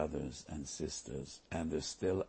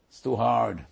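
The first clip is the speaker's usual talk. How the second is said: "too hard" thrown out louder, a whiny groan of giving up; complaining it's too hard, voiced louder; exasperated complaint; 4 semitones higher, 9 dB louder than usual. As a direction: complain like the burden is too heavy. People balking at Torah.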